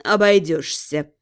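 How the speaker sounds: low slow purr; purring gently. A narrator's reading. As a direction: purr it softly, dismissive. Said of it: "нежно мурлыкнул кот".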